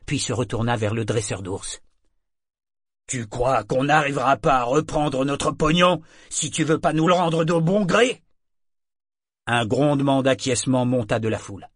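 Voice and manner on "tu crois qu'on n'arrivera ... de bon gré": raised, higher voice, building; angry threat. Acted. Brute warns the trainer he will take the money back, threatening. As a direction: threaten him, climbing toward the end.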